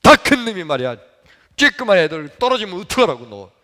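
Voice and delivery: acted angry lecture; angry scolding. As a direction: tell off a big boy hard. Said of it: "얼마나 야단을 치는지".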